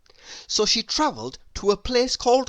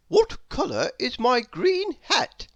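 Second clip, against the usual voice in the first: higher, lightly voiced know-all; a hint of a pompous little man asking; Mister Clever voice; 7 semitones higher than usual. A narrator's reading. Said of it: "he asked".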